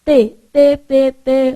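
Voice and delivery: T dito com a língua entre os dentes; repeated T. Shown as a demonstration. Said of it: "Fala T pra você ver". (T dental repetido)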